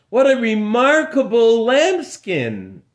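high, loud amazed cry; amazed exclamation. Acted. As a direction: marvel at it aloud. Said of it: "he called out to everyone".

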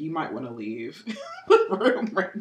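slow warning dissolving into laughter; retold laughing warning. Host retelling how she warned her dad away, laughing. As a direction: let the warning crack into giggles.

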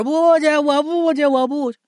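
put-on very high wailing little boy; child's tantrum. A narrator's reading. Grandson refuses to go indoors, high whining tantrum. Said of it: "进了屋也是撒泼打滚带蹬腿儿".

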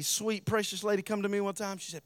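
ordinary talk, nothing marked

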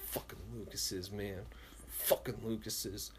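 soft muttered curse under the breath; bitter mutter. Retold or imitated. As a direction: say it low to yourself, seething. Brooding alone at home.

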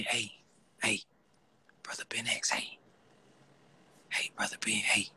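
furtive whispered imitation of a caller; mock whisper. Host mimicking a caller who seemed to buy drugs, whispering. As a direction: whisper it like a secret street deal. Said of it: "Man, got the whispering".

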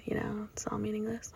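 breathy dark whisper, almost no voice. Real, not acted. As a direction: let it sink into a grim whisper. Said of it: "I got a little dark".

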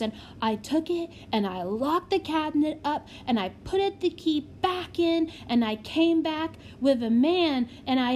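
put-on small child's voice, eagerly recounting; proud child prodigy. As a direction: pipe up like a little kid telling everything.

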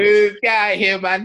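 loud, high heckler shout; loud drunk heckling. Pretend. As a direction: jeer him away. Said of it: "heckling the speaker".

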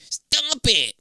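put-on high, annoyed kid's cry. A narrator's reading. Kid kicked in the leg by the brother, crying out. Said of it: "you cry".

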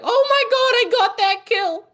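put-on very high, loud kid-gamer squeal; screaming twelve-year-old. Co-host imitating twelve-year-old players, screaming in a high voice. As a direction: imitate an overexcited child shrieking mid-game. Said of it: "twelve-year-olds, like, scream".

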